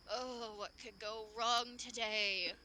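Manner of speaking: slow, tired sigh of a line; weary blacksmith. Acted. Blacksmith rubbing his forehead, slow and weary. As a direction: drag it out like a fed-up smith. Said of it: "and he's just like".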